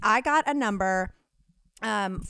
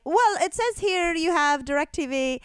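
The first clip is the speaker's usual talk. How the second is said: higher, slow mock telemarketer; insisting caller; 6 semitones higher than usual. Caller insisting on the customer's account, lightly imitated.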